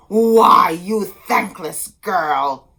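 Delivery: loud, slow furious cry; angry shout. A narrator's reading. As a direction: rage at the ungrateful girl. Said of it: "the sorceress cried".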